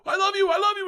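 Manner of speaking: very high, tearful declaration; choked up. Real, not acted. Wife as her husband starts to disappear, high and tearful. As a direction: cry out love while welling up. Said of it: "I'm getting emotional now".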